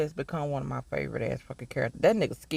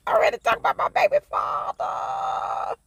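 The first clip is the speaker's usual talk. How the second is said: very high, playful squeal; gushing fangirl squeal. Pretend. Vlogger gushing over her baby father, playful squeal. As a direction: squeal it like a smitten fan. Gushes over favourite character after laughing.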